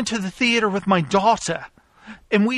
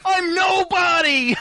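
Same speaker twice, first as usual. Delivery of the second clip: manic laugh; 8 semitones higher than usual. Acted. Manic laugh about being nobody.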